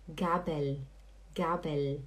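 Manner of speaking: Gabel low and soft, ending on a clear L; correct final L. Shown as a demonstration. Teacher asking student to speak along, clear final L. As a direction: hold the tongue tip up closing Gabel. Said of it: "fala comigo".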